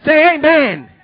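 loud, high call to the crowd; call and response. Acted. Preacher urging the congregation to respond, loud call. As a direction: demand the congregation answer. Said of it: "calls for response".

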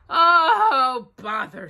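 a touch of bear distress; stuck Pooh. A narrator's reading.

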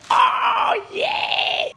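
excited high-pitched whoop; ecstatic shout. Real, not acted. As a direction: yell with pure joy at the new shirts. Excited at new shirts.

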